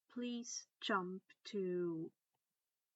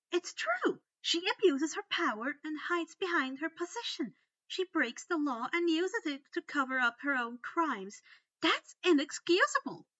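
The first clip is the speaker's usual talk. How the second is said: high, loud outraged rant; righteous fury at authority; indignant tirade; 7 semitones higher, 9 dB louder than usual. Acted. Hermione's angry tirade.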